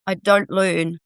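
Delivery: ordinary talk, nothing marked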